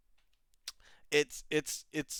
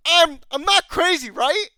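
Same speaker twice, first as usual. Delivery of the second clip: exasperated plea; 7 semitones higher, 8 dB louder than usual. Real, not acted. Pleading for agreement, exasperated.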